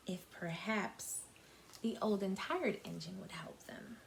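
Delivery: soft unvoiced whisper. Real, not acted. Teacher teasing tomorrow's story, whispered. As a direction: whisper a secret teaser. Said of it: "whispered teaser ending".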